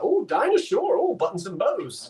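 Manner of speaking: high, lilting swoon over "[name]"; imitated [name] fan. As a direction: lilt it up high, lightly hammed. Recalls singer, voices admiring talk and song.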